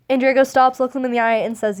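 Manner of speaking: ordinary talk, nothing marked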